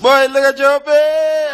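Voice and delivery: slow, high, loud brag; drawn-out bragging call; hype boast. Real, not acted. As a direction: show off big to the camera. Showing off to the camera.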